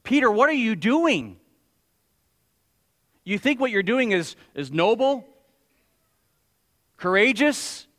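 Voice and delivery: high, loud incredulous questioning; acted rebuke. Jesus to Peter over his rash act, indignant rebuke. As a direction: challenge him word by word, with long pauses.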